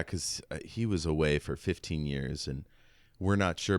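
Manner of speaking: usual speaking voice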